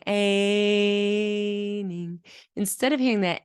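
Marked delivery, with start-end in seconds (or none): 0.1-2.2 "aining" drawn out on its vowel; stretched diphthong. Shown as a demonstration. Demonstrating the diphthong sound in "aining". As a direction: hold the A in "aining". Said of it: "the diphthong".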